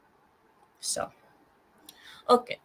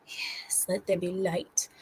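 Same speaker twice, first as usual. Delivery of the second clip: whisper; 3 semitones lower, 8 dB quieter, slower than usual. Acted. Turning on her light.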